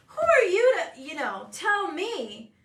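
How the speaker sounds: loud high retort, then slowing; hammed-up who-are-you outrage; mock indignant. Mom snapping back at her son, mock outrage. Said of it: "I'm like".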